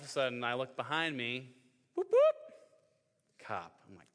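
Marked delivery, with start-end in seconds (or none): 1.9-3.5 high whooping police siren noise; playful high siren whoop; siren imitation. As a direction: mimic a cop siren, high and loud. Imitating police siren behind him.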